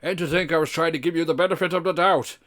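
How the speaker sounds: posh voice. Acted. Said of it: "so he's gonna stay with the posh".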